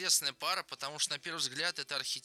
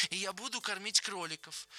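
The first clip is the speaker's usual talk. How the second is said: put-on high, eager childlike voice; excited high-pitched dreaming; happy eagerness; 7 semitones higher than usual. A narrator's reading. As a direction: announce it with delight, pitch lifted. Lennie delighted about the rabbits.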